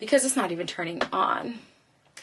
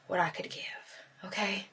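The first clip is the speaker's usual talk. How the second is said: whispering; 10 dB quieter than usual. Real, not acted. Aside to viewers.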